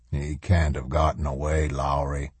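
low, restrained insistence; forceful. A narrator's reading. Colonel rejecting his lieutenant's report, quietly forceful. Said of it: "Macklin said forcefully".

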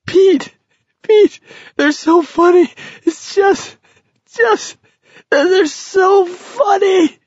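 very high, hysterical, breaking into stutters; weeping with laughter. A narrator's reading. Garrity calls to Pete, nearly weeping with laughter. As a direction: squeal it helplessly, barely getting the words out. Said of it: "nearly weeping with laughter".

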